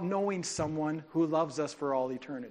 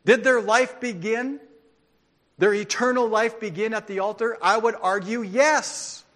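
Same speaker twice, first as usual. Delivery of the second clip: preaching; 5 semitones higher, 7 dB louder than usual. Acted. Preaching at full force.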